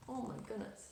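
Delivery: ordinary delivery, nothing marked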